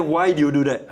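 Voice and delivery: loud, high accusing question; scolding. Shown as a demonstration. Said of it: "I didn't say".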